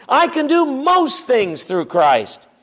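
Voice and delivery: "most" hit, slow and falling; slow, pressing hard on the word "most"; stress on most. Shown as a demonstration. Preacher setting up the contrast, stress on most. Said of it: "Notice it isn't just".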